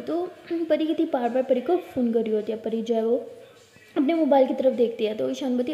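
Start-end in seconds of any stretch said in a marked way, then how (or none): none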